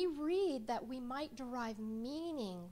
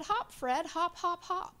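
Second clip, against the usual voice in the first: high, loud sing-song beginner reading; child reading aloud; 7 semitones higher, 8 dB louder, slower than usual. Retold or imitated. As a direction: sound out each word like a five-year-old. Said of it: "And he read".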